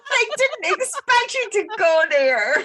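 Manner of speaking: slow, high words through laughter; real laughing fit while talking; helpless laughter. Real, not acted. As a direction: crack up in scandalized surprise. Cracking up at the revelation.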